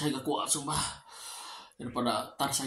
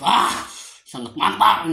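excited; 5 semitones higher, 13 dB louder, slower than usual. Real, not acted. Loud delighted reaction to food.

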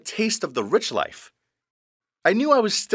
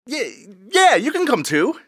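nervous stammer, pitch jumping up; stuttering; 8 semitones higher, 4 dB louder than usual. Retold or imitated. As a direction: trip over the first word. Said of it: "Mark stuttered".

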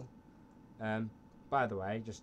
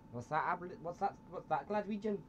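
put-on Scottish accent; 5 semitones higher than usual. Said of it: "a different Scottish accent".

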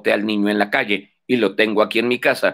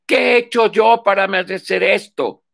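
high, louder mock baby voice, wailing; crying baby's lament; 9 semitones higher, 4 dB louder than usual. Baby in a meme complaining about its fate, high mock whine. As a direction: whine it like a sobbing infant. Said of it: "Y dice".

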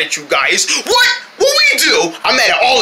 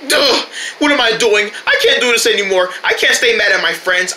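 ordinary talk

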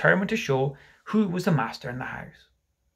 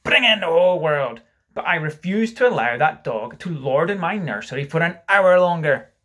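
loud, defiant proclamation; reckless; 4 semitones higher, 7 dB louder than usual. Acted. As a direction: declare it recklessly at full voice. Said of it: "he answered recklessly".

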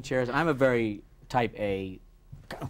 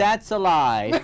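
slow, louder, higher indignant politician; Tsongas impression; 6 semitones higher, 6 dB louder, much slower than usual. Impressionist as Tsongas, slow louder indignant. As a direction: hammer each word slowly, indignant. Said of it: "Zongas turned around and said".

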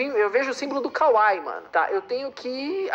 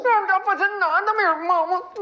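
higher, faster outraged repeat; outraged protest; 9 semitones higher than usual. Real, not acted.

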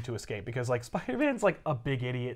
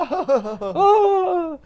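high laughing cry; a hammed-up fleeing wail; mock wail; 7 dB louder than usual. [name] runs off with a hammed-up cry. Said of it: "she runs away".

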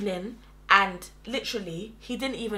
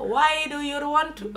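put-on slow, higher stab at a South African accent; botched South African accent; 4 semitones higher, slower than usual. Imitating colleague for the host, botched South African accent. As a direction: labour through a bad South African accent, near laughing. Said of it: "I think she was South African".

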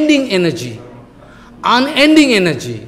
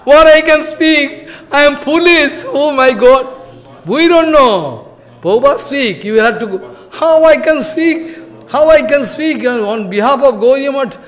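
imitating tearful protest; 6 semitones higher than usual. Disciple begging his guru to spare him, in a high tearful plea. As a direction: whine it out like a frightened disciple who can't do it. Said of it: "Jajavar Goswami started crying".

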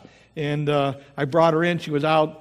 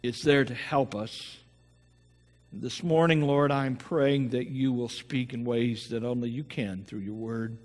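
opening prayer; 4 semitones lower than usual. Acted. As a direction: pray gently over the congregation. Pastor leads the opening prayer.

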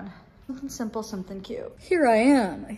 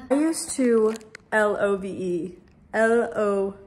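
L O V E spelled letter by letter, slowly; drawn-out spelled love; 5 dB louder, slower than usual. Shown as a demonstration. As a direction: spell out L O V E dramatically. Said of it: "spelling it out".

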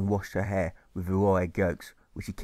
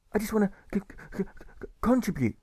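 put-on stammering boy; 10 semitones higher than usual. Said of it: "he tries to explain".

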